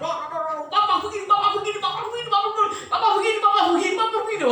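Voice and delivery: high, fast, loud nagging with a laugh in it; nagging wife. Retold or imitated. Wife bombarding her husband with complaints, high nagging. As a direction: fire off complaints like mortar rounds. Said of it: "kalau ada satu yang sedang membombardir".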